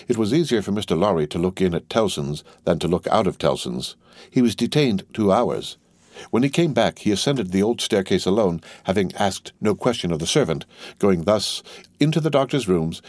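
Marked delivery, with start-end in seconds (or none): none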